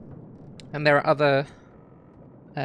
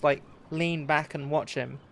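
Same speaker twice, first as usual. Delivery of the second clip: ordinary talk, nothing marked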